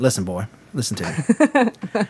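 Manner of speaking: lightly hammed-up Southern preacher drawl; Southern accent. Shown as a demonstration. Preacher trying out the requested voice, mock Southern accent. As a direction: slip briefly into a Southern accent. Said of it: "I'll do it in a Southern accent".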